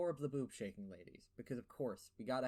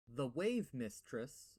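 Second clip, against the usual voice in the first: very slow, deliberate words; slow careful; much slower than usual. Real, not acted. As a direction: spell it out slowly for the lisp. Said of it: "I said that weird because I have a lisp and I had to say it slowly".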